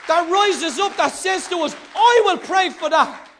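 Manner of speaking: loud, rising prayer crying out to God; fervent prayer. Retold or imitated. Preacher reenacting his prayer, crying out to God loudly. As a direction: pour it out to the Father, building in force. Prays aloud in the story.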